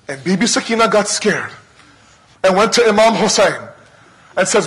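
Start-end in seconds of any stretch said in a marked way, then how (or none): none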